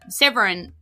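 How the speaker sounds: normal talking voice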